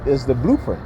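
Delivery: ordinary talk, nothing marked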